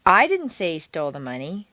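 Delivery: "I" emphasized, rest of sentence lighter; stress on I. Shown as a demonstration. Teacher indicating someone else said it, stress on "I". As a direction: punch "I" to deny being the one. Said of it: "you were not the person to say it".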